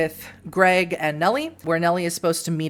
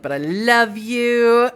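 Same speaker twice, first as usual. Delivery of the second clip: hammed-up lovesick wail, higher and louder; mock-romantic declaration; 5 semitones higher, 5 dB louder, much slower than usual. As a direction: draw "love you" out slowly, melodramatically. Acts out Suzanne's love declaration.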